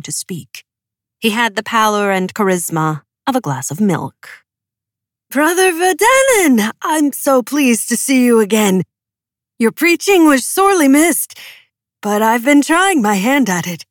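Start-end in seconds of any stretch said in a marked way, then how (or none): 5.3-13.9 high, loud, gushing welcome; higher and louder eager young preacher; eager greeting. A narrator's reading. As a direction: bubble over with delight at seeing him. Azaroff greets Alexander eagerly.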